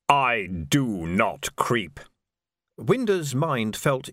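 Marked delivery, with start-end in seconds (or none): 0.1-2.0 very slow, low, weighty voice; Death. A narrator's reading. Correcting the dead lord, slow and solemn. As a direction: intone each word slowly and deep. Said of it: "said Death".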